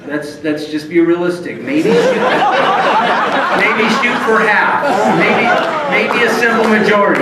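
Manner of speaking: high, loud, backpedalling; alarmed recoil. Retold or imitated. Ron lowering the lawyer's ambitions, alarmed. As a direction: pull back in mock alarm. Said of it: "he recoils quickly and goes".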